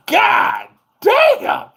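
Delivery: high, loud startled yell; stunned loud God damn; shocked shout. Retold or imitated. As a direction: yelp it in shock. Shocked reaction to dogs.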